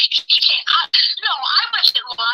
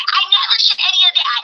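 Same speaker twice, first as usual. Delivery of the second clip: ordinary delivery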